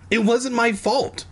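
high shrill protest; shrill cry. Acted. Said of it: "He cried shrilly".

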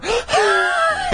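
long breathy exhale; whoosh of air. A narrator's reading. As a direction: let the air whoosh out in relief. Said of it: "let out a long whoosh of air".

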